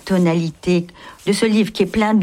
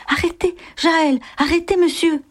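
high-pitched call to stop them; acted crying out; 10 semitones higher than usual. A narrator's reading. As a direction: call out urgently, without much extra volume. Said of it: "je m'écriai".

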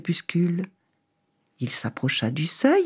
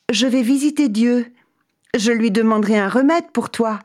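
eager traveller; 7 semitones higher, 3 dB louder than usual. Acted. Traveller promises to visit God.

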